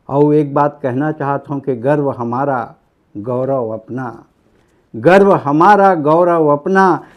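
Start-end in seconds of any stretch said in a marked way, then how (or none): 5.0-7.0 loud, drawn-out opening line of a poem; poem declamation. Real, not acted. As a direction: proclaim it proudly like a stage poet. Same line declaimed to start poem. (जोर से कविता पाठ)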